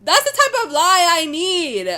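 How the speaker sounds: loud, high, excited approval; delighted shout. Real, not acted. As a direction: yell in triumph at finally being flattered. Delighted by a flattering comparison.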